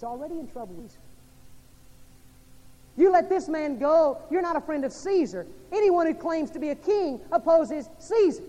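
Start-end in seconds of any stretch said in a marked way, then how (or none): 3.0-8.4 preacher booming the mob's threat; shouted crowd threat. Acted. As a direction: yell it like the crowd at Pilate.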